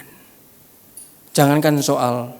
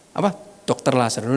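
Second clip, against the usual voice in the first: normal talking voice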